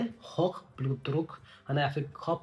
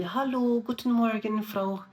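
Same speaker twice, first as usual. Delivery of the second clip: higher, bright-eyed hello to a patient; friendly greeting tone; 7 semitones higher, slower than usual. Shown as a demonstration. Nurse greeting a patient in the morning, friendly higher tone. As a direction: greet her like you're glad to see her. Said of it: "थोड़ा सा tone भी हमें थोड़ा सा, अ, वैसा बनाना पड़ता है".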